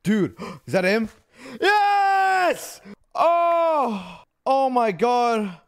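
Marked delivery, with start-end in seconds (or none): 1.6-5.6 loud joyful cry of relief; overjoyed reunion. Real, not acted. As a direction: greet the lost pet with joy. Finds horse, overjoyed.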